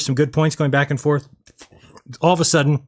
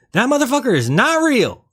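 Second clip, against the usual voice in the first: high-pitched indignant exclamation; emphatic outburst; 12 semitones higher, 3 dB louder than usual. Real, not acted. Streamer reacting to talk of McDonald's, emphatic high outburst. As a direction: burst out way up high, hammering it home.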